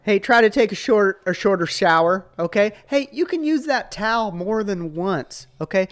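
high, loud mock-dad lecturing; hammed-up fatherly complaint with pushy okays; nagging dad. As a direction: nag the kids in an exaggerated dad voice. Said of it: "Anyone that has a dad".

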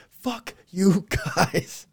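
loud, high, drawn-out mock curse; hammed-up, slow-motion outburst; mock outrage. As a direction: stretch each word out in pretend indignation. Same imagined reaction, blown up.

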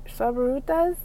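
a light Spanish accent on the name. Shown as a demonstration. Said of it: "I said that in, like, a Spanish accent".

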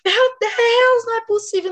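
high, loud mock panic; hammed-up Meu Deus panic. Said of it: "student despairs".

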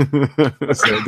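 laughing through the words. Real, not acted. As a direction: giggle it out.

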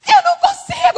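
very high, loud mock wail; crying girl. Weeping girl telling the missionary she can't, high loud imitated bawl. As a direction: bawl it helplessly in a squeaky voice. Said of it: "veio uma menina chorando".

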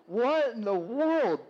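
slow, high, laughing "what in the world"; laughing disbelief. Retold or imitated. Pastor laughing at people standing in front of him, slow and high. Crowd blocks his view, he laughs.

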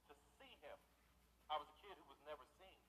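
ordinary talk

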